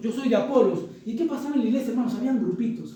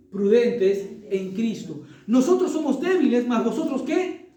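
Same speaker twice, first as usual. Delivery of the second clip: sarcasm; slower than usual. Retold or imitated. Preacher reading Paul's sarcasm to the church, mocking. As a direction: rise in pitch to mock their strength. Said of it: "ese es puro sarcasmo".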